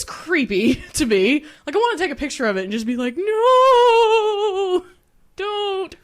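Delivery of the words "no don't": mock horror scream.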